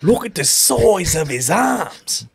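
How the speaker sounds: slow, high mock Australian marveling; Australian accent. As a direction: play along as the Aussie host. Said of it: "continue that accent".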